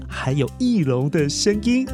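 ordinary delivery

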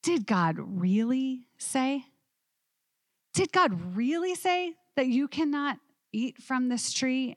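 slow, sly hint of a serpent; serpent's tempting voice. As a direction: draw the question out like a tempter. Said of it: "What did the serpent say to them?".

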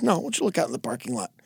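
soft, low, very quick repeat; cool insistence. Acted. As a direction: insist quietly and fast. Superman repeating his order.

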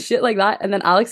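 ordinary talk, nothing marked

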